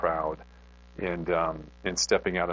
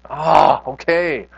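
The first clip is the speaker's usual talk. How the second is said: reluctant giving in; 7 semitones higher, 10 dB louder, much slower than usual. Retold or imitated. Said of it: "And I'm like".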